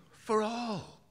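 high, sweet pious voice; pious young woman. A narrator's reading. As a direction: say it like a believer. Fiona's pious reply.

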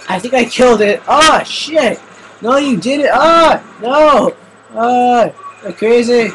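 loud high frantic shouting; panicked shouting. Real, not acted. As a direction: yell in real panic. Panic after killing something.

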